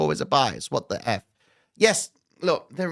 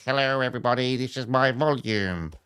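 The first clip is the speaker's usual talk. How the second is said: helium voice. Retold or imitated. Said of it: "helium voice".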